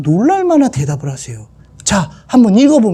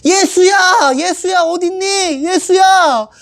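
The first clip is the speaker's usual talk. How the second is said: put-on high calling for a lost child; 9 semitones higher, slower than usual. Joseph searches the temple, calling the boy's name. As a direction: call across the crowd.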